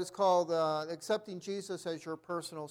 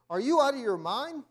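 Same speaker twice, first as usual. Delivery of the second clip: higher, louder indignant question; shocked protest; 6 semitones higher, 6 dB louder than usual. A narrator's reading. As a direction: challenge him as if he's gone mad. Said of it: "the young preacher protested".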